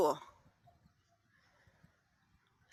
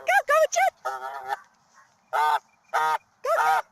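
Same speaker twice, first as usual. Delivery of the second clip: urging them on; faster than usual. Real, not acted. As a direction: egg the animals on at the top of the voice. Urging the running dog and bird.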